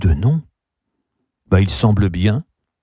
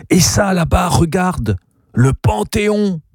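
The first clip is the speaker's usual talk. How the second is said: much higher, eager pointing, last words stretched; excited exclamation; 8 semitones higher than usual. A narrator's reading. Gabriel showing Zazie the Panthéon, excited exclamation. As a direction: show off a great sight. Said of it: "il s'exclama de nouveau".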